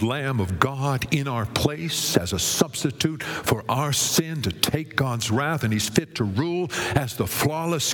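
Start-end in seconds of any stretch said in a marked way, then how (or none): none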